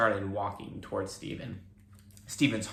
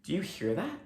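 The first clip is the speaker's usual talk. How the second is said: put-on boy asking; 8 semitones higher than usual. A narrator's reading. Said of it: "Stephen asked".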